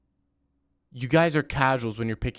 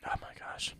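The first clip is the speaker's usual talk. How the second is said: whisper; 13 dB quieter than usual. Real, not acted. Tired streamer whispering.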